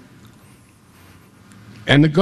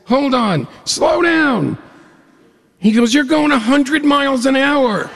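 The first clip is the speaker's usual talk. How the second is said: panicked passenger; 13 semitones higher than usual. Retold or imitated. Jake panicking at high speed.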